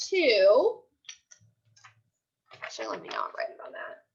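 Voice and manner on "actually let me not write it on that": quick whispered note to herself; whispered aside. Real, not acted. Teacher mutters to herself mid-lesson in a quick whisper. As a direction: drop to a fast whisper, talking to yourself. Aside while setting up the task.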